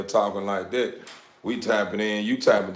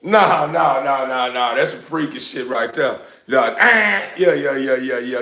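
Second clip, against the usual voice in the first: laughing disgust; 4 semitones higher, 6 dB louder than usual. Real, not acted.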